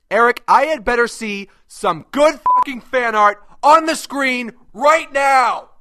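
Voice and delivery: high, loud furious yell; angry shout. Acted. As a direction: scream the order at Eric. Yelling at Eric for art.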